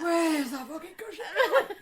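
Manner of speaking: high, loud, slow hammed-up outburst through laughter; mock angry mum. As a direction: fake a furious mum hunting her cushion. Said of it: "And she was like".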